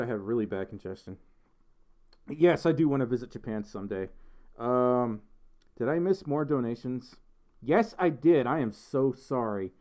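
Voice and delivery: normal talking voice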